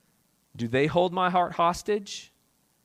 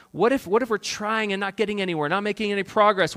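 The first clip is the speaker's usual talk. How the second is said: ordinary delivery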